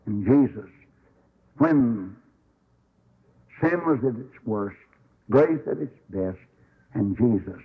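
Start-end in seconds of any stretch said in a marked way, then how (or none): none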